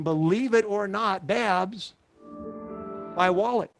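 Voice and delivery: ordinary delivery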